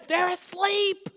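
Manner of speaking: exasperated hushed protest in a high voice; aggravated neighbour. Acted. Woken neighbour answers caller.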